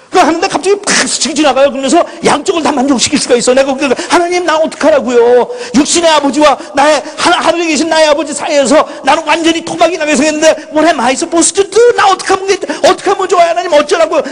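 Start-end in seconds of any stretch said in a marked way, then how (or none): 4.1-14.2 high, pleading cry to God, half-retold; desperate plea. Retold anguished prayer while driving. (높은 목소리로 절박하게 하소연)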